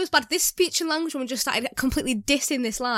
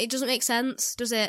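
ordinary delivery

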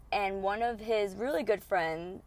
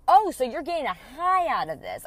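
higher, louder mocking realization; sarcastic accusation; 5 semitones higher, 5 dB louder than usual. [name] accusing her ex of enjoying it, sarcastic. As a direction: catch him out, sarcastic. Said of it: "I'm like".

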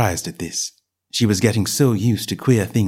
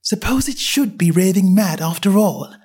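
put-on young girl voice; 8 semitones higher than usual. A narrator's reading. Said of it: "saying to herself".

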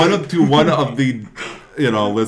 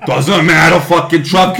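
higher, louder, laughing rant; mock angry boss; 6 semitones higher, 8 dB louder than usual. Boss ranting at Dreyfus over the garbage truck, loud and laughing. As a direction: rant at the worker like a cartoon boss.

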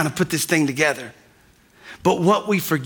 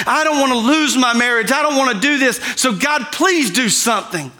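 high, urgent plea to God; impassioned prayer in a raised pitch; crying out; 5 semitones higher than usual. Acted. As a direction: plead desperately, piling up each appeal. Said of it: "He was crying out to God".

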